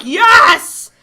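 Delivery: loud triumphant cheer; excited yes. Retold or imitated. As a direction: shout it like a dream came true. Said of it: "You were like".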